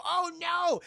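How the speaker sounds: high, loud alarm; alarmed cry. Retold or imitated. Group spotting the bug in the stairwell, loud alarmed cry. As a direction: yelp as the bug jumps out.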